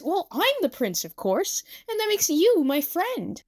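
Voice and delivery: high, swooping self-proclamation; hammy proud flourish; grand prince. Acted. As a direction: declare it with princely pomp. Self-styled prince declares himself.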